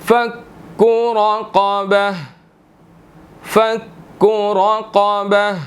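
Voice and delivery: slow, drawn-out chanted recitation; measured tilawat before the lesson; Quranic recitation. Shown as a demonstration. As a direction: recite it slowly, stretching each vowel. Teacher recites the verse.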